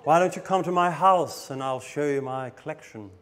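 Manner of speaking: put-on eccentric collector voice; imitated oddball host, in a voice unlike your own; Jeffrey. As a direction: mimic a creepy collector's invitation. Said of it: "That's the way he spoke".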